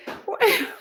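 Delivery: short high laugh; a quick high chuckle. Real, not acted. Streamer amused by a viewer's question, short high laugh.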